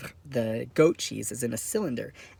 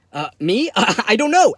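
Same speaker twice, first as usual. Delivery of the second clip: flustered; 7 semitones higher, 8 dB louder than usual. Acted. Flustered answer to imagined inmate.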